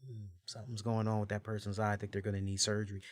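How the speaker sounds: soft, quick aside under the breath; quietly. Retold or imitated. Host retelling a quiet guess about someone's eye, said softly. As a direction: murmur it quietly, fast. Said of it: "I quietly".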